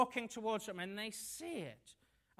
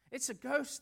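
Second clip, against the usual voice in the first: frightened cry, louder and higher; cried out in fear; 5 semitones higher, 5 dB louder than usual. A narrator's reading. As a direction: yelp it in terror. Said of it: "cried out in fear".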